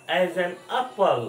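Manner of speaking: very slow As an apple, As with a short "uh". Shown as a demonstration. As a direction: drag As an apple out slowly. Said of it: "उसका pronunciation अ".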